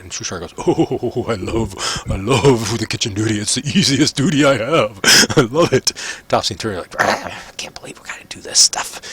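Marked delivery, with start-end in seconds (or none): 0.6-5.9 cheerful, chuckling put-on character voice; Shushar. 7.0-9.0 whispery, disgruntled groan; grumbling prisoners. Acted. Twins grumble about kitchen duty.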